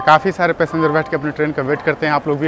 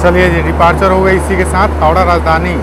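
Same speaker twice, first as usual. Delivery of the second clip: loud over train noise; 3 semitones higher, 10 dB louder than usual. Real, not acted. Calling over departing train noise.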